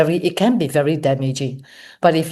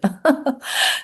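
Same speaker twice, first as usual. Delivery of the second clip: short, higher-pitched laugh; amused chuckle; 10 semitones higher than usual. Real, not acted. Teacher joking about the non-stop radio station, amused chuckle. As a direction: chuckle briefly at your own joke.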